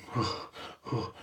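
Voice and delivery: soft, heavy puffs of breath; mock winded huffing. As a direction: huff as if worn out from running. Acts out running out of breath.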